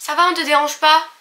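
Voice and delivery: high, loud teasing pet talk; mock offended to pet. Real, not acted. Youtubeuse taquine son animal, voix aiguë et forte.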